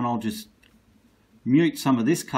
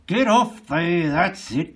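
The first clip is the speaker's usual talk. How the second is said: 1 loud urging; 6 semitones higher, 6 dB louder, slower than usual. Real, not acted. Urging paint off brush.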